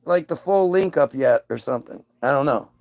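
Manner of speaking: ordinary talk, nothing marked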